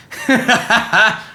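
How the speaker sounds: real burst of laughter; laughing. Real, not acted. Laughing at his own Spider-Man bit. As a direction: break into a laugh.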